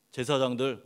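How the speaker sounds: ordinary talk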